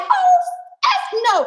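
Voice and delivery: high, loud startled outburst; retold shock. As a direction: recoil loudly from a landed bug, pitch shooting up. Retold shock at roach on wig.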